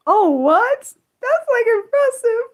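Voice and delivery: high, loud burst of excitement; excited. Real, not acted. Streamer amazed by someone's language skills, excited. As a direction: react with surprised, impressed excitement, voice shooting up. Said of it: "I got excited for a second".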